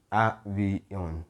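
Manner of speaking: "Avión" broken into three syllables, A-vi-ón. Shown as a demonstration. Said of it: "right, that has three".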